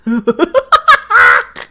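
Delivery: high, loud cackle; laughing fit. Real, not acted. Player cracking up at the game, laughing loudly.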